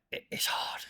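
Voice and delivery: soft unvoiced murmur of it's hard; whispered aside. Real, not acted. Musing on how hard starting out is, whispered. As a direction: drop to a breath, almost to yourself.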